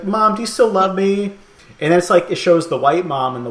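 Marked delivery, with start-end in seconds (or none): 0.0-1.3 put-on pleading kid. Kid voiced in retold ad.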